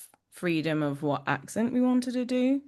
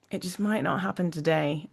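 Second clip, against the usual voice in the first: ordinary delivery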